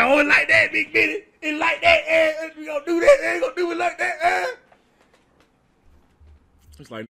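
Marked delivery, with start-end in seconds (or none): 0.0-4.5 high, loud mock-offended Willie; indignant Willie. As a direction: ham up the wounded pride.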